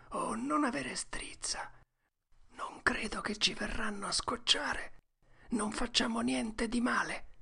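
high, soft, breathy reassurance; steady your friend in a whisper; hushed boy Tom. A narrator's reading. Tom tells Huck they must stay still, hushed.

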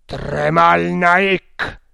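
very slow, booming summons; loud, menacing challenge; terrible shout. A narrator's reading. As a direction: roar the name, stretching each syllable. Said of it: "gridò Sudoyana con terribile accento".

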